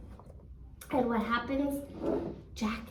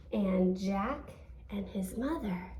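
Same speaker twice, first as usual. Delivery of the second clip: ordinary delivery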